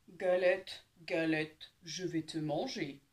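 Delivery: low, loud, slow bear growl; big bear voice. Acted. The big bear growls at the pancake, low and slow. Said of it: "grogne l'ours de sa grosse voix".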